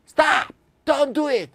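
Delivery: high, loud commanding shout; shouting stop. Shown as a demonstration. Teacher demonstrating shouting for the class, loud. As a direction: yell it to stop someone. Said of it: "큰 소리로 외친다는 걸 알 수 있죠".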